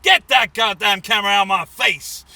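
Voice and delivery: furious man yelling at the news camera; angry yell. Retold or imitated. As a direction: bark it at the camera. Man shoves CNN camera away.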